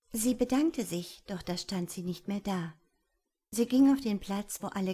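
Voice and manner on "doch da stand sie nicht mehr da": ordinary delivery, nothing marked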